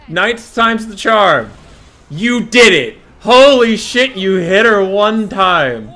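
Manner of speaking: loud, high mock congratulations, slow; sarcastic yelling. Real, not acted. Gamer mocking Imric for finally landing a hit. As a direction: cheer with bitter sarcasm. Sarcastic praise after ninth try.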